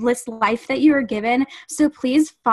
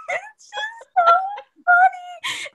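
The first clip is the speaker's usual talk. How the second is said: high-pitched laughing through the words; barely getting it out for laughing; helpless giggling; laughing hard; slower than usual. Real, not acted. Cracking up over the Vine.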